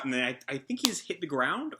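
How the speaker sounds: usual speaking voice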